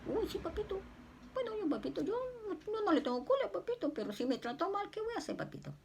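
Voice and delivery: put-on high, soft little-girl plea to papito; childlike pleading. Speaking to Jesus, a high, soft childlike plea. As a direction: whine sweetly like a small child.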